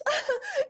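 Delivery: sudden high giggle; high laugh. Real, not acted. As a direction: let out a giggle. Nervous laugh.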